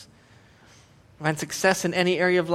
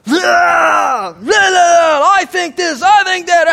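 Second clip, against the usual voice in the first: hammed-up, high-pitched shouted rant; emotional vomiting; 13 semitones higher, 13 dB louder, slower than usual. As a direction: spew opinions in a loud mock outburst. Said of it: "emotionally vomit all over each other".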